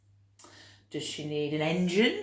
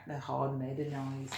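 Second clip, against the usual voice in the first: ordinary delivery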